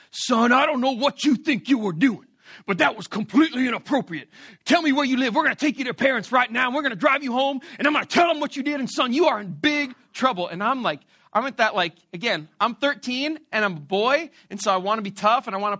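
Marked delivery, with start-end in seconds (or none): 0.1-10.5 mock stern police scolding, slightly raised; cop tearing into kid. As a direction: imitate a cop laying down the law. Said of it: "he just tears into me".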